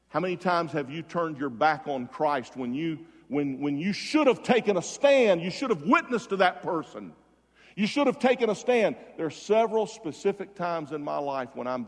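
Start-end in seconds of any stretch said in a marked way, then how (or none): 3.3-8.9 high, loud preaching; forceful exhortation. Real, not acted. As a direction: press the congregation hard, peaking on the repeat. Preacher exhorting congregation about missed chances.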